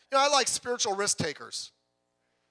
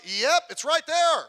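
slow, louder deadpan reading; mock matter-of-fact; 5 semitones higher, 7 dB louder, much slower than usual. Pastor voicing the Bible's blunt answer, slow and louder. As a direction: lean on it, slow, as if pointing at the page. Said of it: "it's like".

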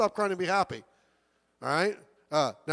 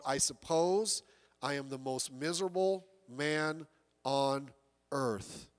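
hushed mournful quote; 7 semitones lower, 7 dB quieter, slower than usual. Retold or imitated. Quoting dying Jay Gould.

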